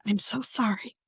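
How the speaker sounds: soft, small mock apology; apologetic self-quote. Host quoting herself apologizing for mispronunciations, softly sheepish. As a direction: drop the volume for a sheepish sorry. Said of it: "I'm just like".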